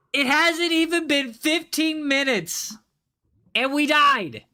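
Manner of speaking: high, slow, stunned wail of disbelief. Real, not acted. Streamer to chat after dying, stunned high disbelief. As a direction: drag it out in disbelief at the sudden death. Streamer shocked at sudden death.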